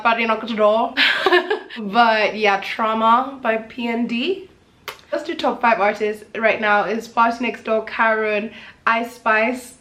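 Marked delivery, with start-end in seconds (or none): none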